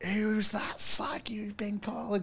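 slow, high, whispery mock mum; imitating mum. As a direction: put on a high, drawn-out mum voice. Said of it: "my mum went".

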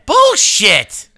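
sudden high indignant yell; loud protest. Real, not acted. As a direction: shout it down in protest. Mock outrage at being comic relief.

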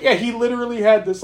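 usual speaking voice

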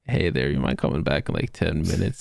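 lightly voiced drowsy man, pitched low; a hint of low, sleepy mock voice; sleepy teacher. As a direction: mimic a napping colleague, low and casual. Imitates sleepy teacher poking head out.

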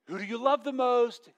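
put-on a touch of baby talk; doting dad. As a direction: coax the answer you want to hear. Dad quizzing his child.

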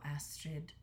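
whispered name; soft whisper. A narrator's reading. Dragon whispering the princess's name gently. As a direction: breathe her name softly.

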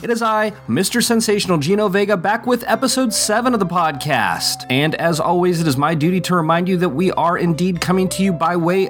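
showman host welcome; ringmaster. Acted. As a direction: present it like a circus showman. Host's showman opening.

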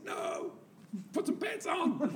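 high, laughing shout; laughing protest. Retold or imitated. Telling his friend to put pants on, laughing high shout. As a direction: crack up while ordering him to dress.

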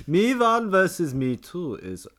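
mock annoyed German reading; annoyed German accent. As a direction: read it like an irritated German. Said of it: "Can you read out as a German? An annoyed German".

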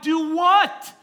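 high, loud shouted question; mock incredulous yell. Pastor answering the upset teen, mock incredulous yell. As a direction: yell back, acting baffled. Acting out shouted exchange at door.

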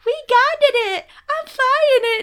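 put-on high, slow toddler squeal; loud little-kid voice, drawn out; adorable little boy. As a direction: imitate a cute, proud tot up high. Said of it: "it's so fucking adorable, 'cause he's like".

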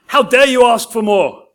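loud angry scolding; furious rebuke. A narrator's reading. Master chases Oliver with his cane, angry shout. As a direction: tear into the boy.

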